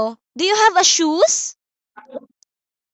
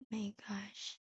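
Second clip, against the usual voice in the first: whispered aside; 6 semitones lower, 27 dB quieter than usual. Real, not acted. Teacher aside, online lesson.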